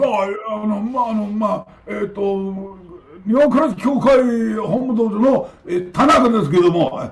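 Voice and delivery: high, loud Japanese teacher voice, stammering fillers; hesitant formal phone introduction; Mister Tanaka voice. Retold or imitated. Tanaka introduces himself on the phone, formal and halting. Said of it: "this is Mister Tanaka".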